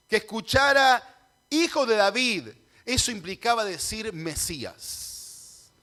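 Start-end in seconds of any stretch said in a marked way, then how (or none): 0.1-2.5 louder, higher preaching emphasis; proclamación enérgica. Acted. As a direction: ring out the title. Predicador proclama el título mesiánico. (proclamación alta y enérgica)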